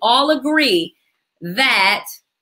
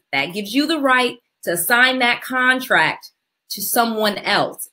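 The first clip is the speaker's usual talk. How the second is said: slow and raised, step by step; slow, careful recap; 3 semitones higher than usual. Real, not acted. Instructor recapping for callers after going too fast, slow and careful. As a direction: spell out each step slowly, with spaces between. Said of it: "'cause I said that really fast".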